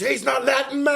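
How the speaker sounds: indignant mock shout; retold shouting. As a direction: re-enact yelling your complaint to the crowd. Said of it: "I started shouting".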